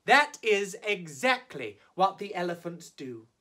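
plain reading voice

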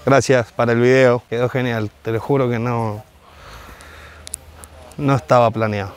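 muffled behind cloth mask; speaking through filter mask; through a face mask. Real, not acted.

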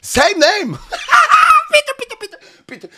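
high, loud, laughing begging; excited laughing plea. Real, not acted. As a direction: squeal with delight and beg for the line.

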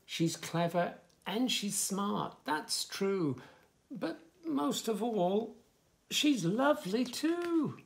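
lively, sing-song story reading; reading to children. Acted. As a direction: bounce the rhymes for little listeners. Reads book text.

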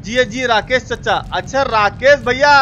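ordinary talk

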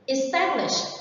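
"Establish" with the stress on -TAB-; esTABlish, clearly modelled word; stress on second syllable. Shown as a demonstration. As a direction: lean on the middle syllable of "Establish". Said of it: "the stress is on the second one".

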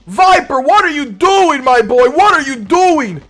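playful mock outrage; mock anger. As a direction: pretend to chew out the teammate. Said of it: "I'm, I'm angry".

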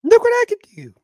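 put-on high squeaky child showing off; little kid voice.

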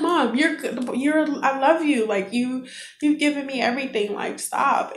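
gently protest, as a son comforting his mom; lightly voiced reassuring son. Son telling tearful mom she's good, lightly imitated reassurance.

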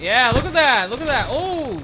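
loud, high whooping hype; excited cheering. Real, not acted. Gamer cheering his own kills, excited.

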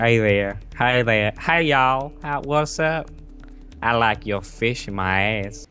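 hammed-up Southern twang; put-on Kentucky drawl; Kentucky-Texas accent. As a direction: play a mock Texan howdy. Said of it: "Kentucky, Texas accent".

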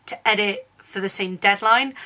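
usual speaking voice

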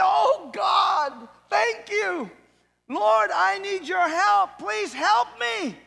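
high, loud, pleading thanks; man on the cliff pleading with God; desperate relief. Acted. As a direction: beg with relief.

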